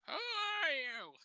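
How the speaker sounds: put-on a drawn-out, high querying old lady; high, slow old resident. Resident quizzing the activities worker, high slow query. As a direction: stretch it out, way up high.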